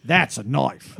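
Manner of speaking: put-on Australian accent; Ruth Brown. Shown as a demonstration. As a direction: imitate a coworker's Australian accent on a film line. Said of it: "now I gotta convert that".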